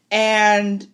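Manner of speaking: usual speaking voice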